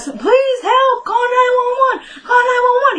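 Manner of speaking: high, loud crying plea; panicked scream for help. Retold or imitated. Retelling Kelly, screaming to call nine one one. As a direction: wail it like she's fighting for her life.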